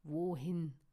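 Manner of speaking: low, loud wolfish growl; forceful, rumbling question; deep growl. A narrator's reading. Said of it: "knurrte Lucian tief".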